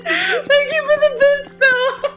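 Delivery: high, laughing through near tears; laugh-crying. Real, not acted. Streamer thanking chat for bits, laugh-crying high. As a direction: laugh and cry at once. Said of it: "I was, cry".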